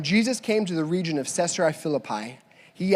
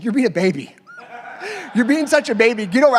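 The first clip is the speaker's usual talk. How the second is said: mock scolding; 5 semitones higher, 4 dB louder, faster than usual. Wife scolding in retold story.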